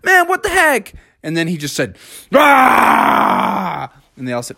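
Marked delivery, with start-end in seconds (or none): 0.0-0.9 high, loud group griping; acted exasperated protest; complaining. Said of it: "really complain at him". 2.3-3.9 high, long roar; cartoon T-Rex bellow; angry dinosaur roar. T-Rex loses his temper.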